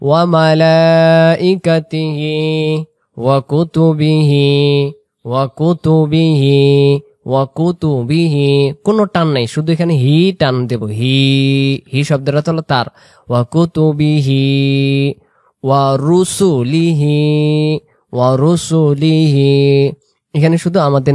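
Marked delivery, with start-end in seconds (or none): 0.1-8.7 slow, drawn-out Arabic recitation; recitation demo. Shown as a demonstration. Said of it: "চলুন আমরা ঈমানে মুফাসসল একটু উচ্চারণটা করি". 13.3-19.9 slow, drawn-out Arabic recitation; recitation demo. Shown as a demonstration. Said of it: "উচ্চারণটা করি".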